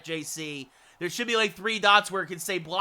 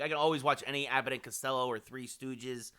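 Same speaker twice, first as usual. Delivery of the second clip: ordinary delivery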